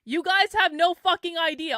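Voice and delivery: loud, high-pitched exasperated outburst; angry yelling. Real, not acted. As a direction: let the critics have it at full voice. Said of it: "Why are you yelling?".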